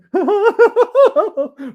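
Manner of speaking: very high, loud, full-throated laugh; Scrooge's first laugh in years; splendid laugh. Acted. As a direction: let out a glorious laugh. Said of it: "it was a splendid laugh".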